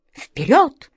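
high little mouse barking a command; rally the troops, tiny but fierce. A narrator's reading. The yellow mouse leading the others, a high command. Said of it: "скомандовала жёлтая мышь".

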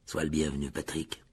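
low, stiffly polite welcome; stiff Nick. A narrator's reading. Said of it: "dit Nick d'un ton raide". (ton raide, voix grave)

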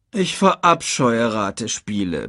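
grumpy lion's grumble; grumbling. A narrator's reading. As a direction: grumble at the bothersome cub. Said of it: "brummte Scar".

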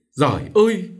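high, loud anguished moan; moaning cry. A narrator's reading. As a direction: cry out to heaven in pain. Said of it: "Bà cụ cựa rên rỉ".

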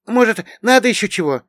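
put-on high, loud young woman's question; eager housemaid Afinka. A narrator's reading. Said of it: "поинтересовалась она". (высокий голос услужливой хозяюшки)

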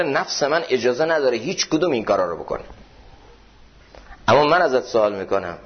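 ordinary talk, nothing marked

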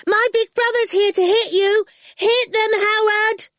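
put-on high, loud little-girl shout; a bratty eight-year-old yelling for backup; shrieking child calling her brother. A narrator's reading. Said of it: "Awful was shouting".

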